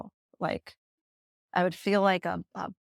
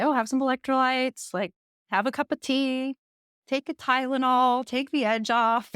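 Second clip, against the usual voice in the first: higher, lightly voiced mom advice; imitated mom; 5 semitones higher than usual. Mom advising anxious daughter on the phone, lightly imitated motherly voice. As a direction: imitate a mother offering easy remedies. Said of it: "And she'd say".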